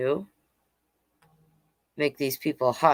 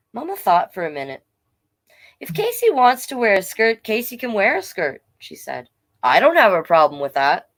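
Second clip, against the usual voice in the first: story narration; 5 semitones higher than usual. A narrator's reading. Reads picture book aloud.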